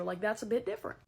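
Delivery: ordinary talk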